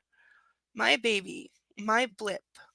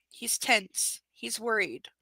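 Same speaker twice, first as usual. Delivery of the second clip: ordinary delivery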